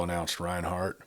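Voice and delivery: usual speaking voice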